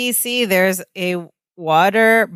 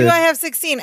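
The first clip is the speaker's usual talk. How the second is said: much higher, bright auctioneer's call; 9 semitones higher than usual. Retold or imitated. Auctioneer calling the bid to the room, higher. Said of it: "And the auctioneer goes".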